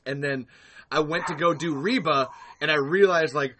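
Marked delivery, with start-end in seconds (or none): none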